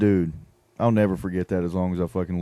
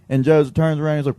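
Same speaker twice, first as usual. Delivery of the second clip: normal talking voice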